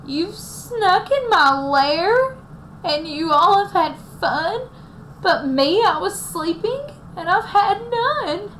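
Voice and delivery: high, slow, sulky bear voice; whiny upset bear. Acted. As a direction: whine it like a tired bear.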